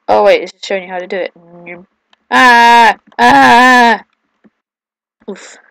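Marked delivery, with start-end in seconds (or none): 2.3-4.0 two loud wordless yells; repeated screams. Real, not acted. Player failing a level, repeated loud screams. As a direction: scream it out, then once more.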